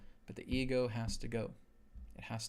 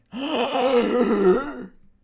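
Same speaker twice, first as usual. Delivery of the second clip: huge theatrical gasp, much higher and louder; loud horrified gasp; 11 semitones higher, 15 dB louder than usual. Pretend. Ego acting out horror at being killed, loud shocked gasp. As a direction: gasp like the ego watching its own death.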